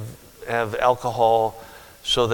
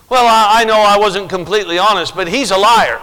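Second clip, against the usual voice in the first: high, loud whiny protest; mock excuse-maker; 7 semitones higher, 9 dB louder than usual. Preacher voicing a sinner's excuses, whiny and loud. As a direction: whine like someone dodging blame.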